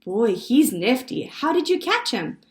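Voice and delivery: loud, high-rising admiration; excited boy. A narrator's reading. Benji admiring his brother's frog, excited. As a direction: marvel loudly like an impressed kid.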